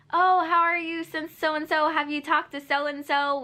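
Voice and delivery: high prying mock friend voice; nosy friend voice. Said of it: "they tend to bring up your ex".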